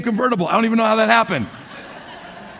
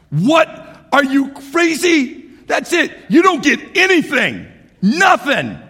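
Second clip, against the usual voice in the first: high, loud, indignant outburst; a father who can't believe his ears; loud exasperated rant, pitch jumping up; outraged parent; 5 semitones higher, 4 dB louder, slower than usual. Retold or imitated. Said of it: "I would be like".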